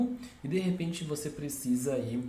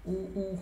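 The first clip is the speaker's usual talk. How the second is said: high drawn-out hoot; train horn imitation; 7 semitones higher than usual. Shown as a demonstration. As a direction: copy the train horn, both sounds long. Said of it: "Esse u, ele imita aquele som". (buzina de trem imitada, aguda)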